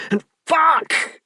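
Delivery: sharp high cry; a high, loud cry at the peak; climax cry. Acted. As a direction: break into a high sudden cry.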